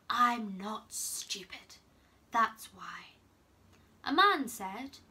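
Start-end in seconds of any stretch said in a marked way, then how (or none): 0.1-3.1 slow husky voice sinking to a whisper. Acted. Manfred explaining himself in a slow husky voice. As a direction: rasp it, then drop to a whisper. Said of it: "The husky voice again".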